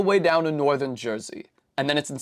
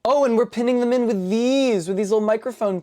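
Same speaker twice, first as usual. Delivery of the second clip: light mock excitement; 8 semitones higher, 3 dB louder than usual. Playful build narration.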